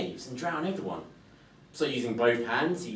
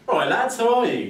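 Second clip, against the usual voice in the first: much higher, louder breezy greeting; cheery boy; 10 semitones higher, 10 dB louder than usual. Acted. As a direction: hail rough robbers like old friends. Cheeky boy greets robbers.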